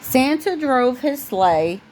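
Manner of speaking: plain reading voice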